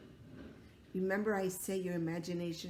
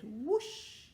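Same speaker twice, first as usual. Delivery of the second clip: higher, louder whoosh; magic whoosh; 6 semitones higher, 4 dB louder than usual. Acted. Dad casting a trick with a whoosh. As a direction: swish it like a wand.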